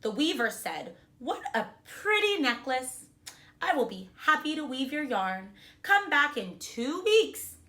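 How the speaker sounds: put-on reading a storybook to children; bright, expressive read-aloud; kindergarten teacher voice. A narrator's reading. Teacher reads picture book aloud.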